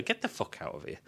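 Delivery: breathy whispered aside, dropping right down; whispered disbelief. Real, not acted. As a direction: mutter it under your breath in disbelief. Disbelief at tiny skin creature.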